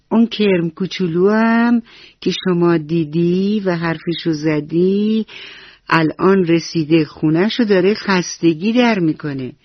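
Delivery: ordinary delivery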